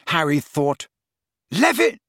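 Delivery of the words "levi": high cut-off shout; shouted spell. A narrator's reading. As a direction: yell and get cut off.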